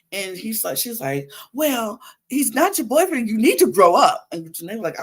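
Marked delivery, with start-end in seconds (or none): 1.5-4.3 higher, building to a loud scold; mock huffy comeback, louder at the end; acted indignant retort. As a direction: imitate her telling a rival off. Acts out Leah's retort in quarrel.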